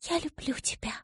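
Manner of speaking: barely audible, tender whisper; tearful whisper. A narrator's reading. Daughter saying farewell to her mother, tearful whisper. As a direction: whisper holding back tears. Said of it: "едва сдерживая слёзы, прошептала".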